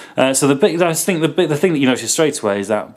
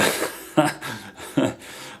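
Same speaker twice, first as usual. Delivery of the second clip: breathy helpless giggle; real laugh at a silly word; 4 dB quieter than usual. Real, not acted. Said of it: "Can't stop laughing when I say that".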